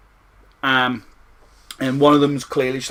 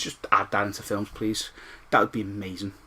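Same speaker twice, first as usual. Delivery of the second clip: ordinary talk, nothing marked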